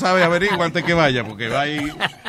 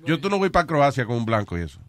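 ordinary delivery

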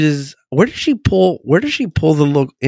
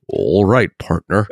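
low Texan cowboy drawl; Texan accent; 6 semitones lower, slower than usual. Acted. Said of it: "you have to have a Texan accent".

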